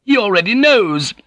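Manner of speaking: very high, panicked outburst; blurted out. A narrator's reading. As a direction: blurt it out to save your life. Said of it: "Jozen blurted out".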